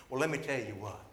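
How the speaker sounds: ordinary delivery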